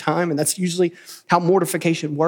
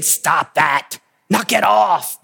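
mock scolding; 3 dB louder, much slower than usual. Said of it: "I can't imagine me saying".

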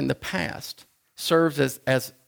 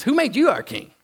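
higher, mocking retort; defiant challenge; 9 semitones higher, 3 dB louder than usual. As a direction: throw the question back scornfully. Israelite challenges Moses, retold.

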